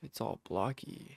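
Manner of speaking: hushed whisper in the silence. Real, not acted. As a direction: whisper so the quiet stays. No game sound, he whispers.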